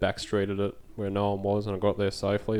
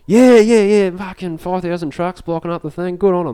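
mock-excited bloke, much higher and louder; excited truckie; 10 semitones higher, 9 dB louder than usual. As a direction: cheer it like a fired-up truckie. Driver cheering the trucker convoy.

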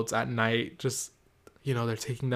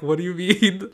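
higher, louder disbelieving question; stunned question; 6 semitones higher, 6 dB louder than usual. Retold or imitated. Asking the worker about the boxes, stunned. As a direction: blurt it out in disbelief. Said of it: "and I'm like".

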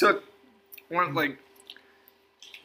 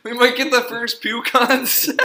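laughing; 6 semitones higher than usual. Real, not acted. Friend grimacing at gross bean.

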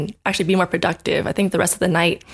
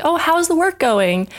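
casual roommate; 9 semitones higher than usual. Retold or imitated. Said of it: "they were just casually asking me".

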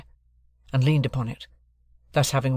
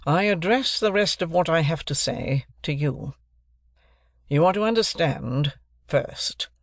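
raised, deliberate lawyer's voice; stern lawyer; 2 semitones higher than usual. Acted. Jaggers introducing himself to Joe and Pip, stern lawyer. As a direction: announce yourself like a cool London lawyer.

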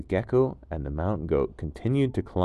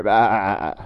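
goat bleat; 9 dB louder than usual. Acted. Goat bleat after speech.

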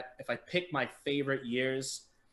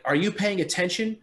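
imitated angry scolding, raised and higher; snapping staff member; 5 semitones higher, 5 dB louder than usual. Staff member to member on finals day, snapping. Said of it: "one of the staff members snapped".

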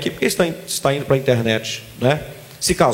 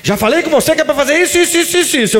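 much higher nagging wife voice; mock wife nagging dogs, higher; wife scolding dogs; 9 semitones higher than usual. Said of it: "Mulher consegue falar com o cachorro".

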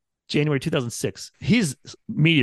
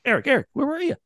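higher mock complaint from the boss; boss complaining; 11 semitones higher than usual. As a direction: imitate the boss asking where you were. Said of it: "Not yell at me, but like".

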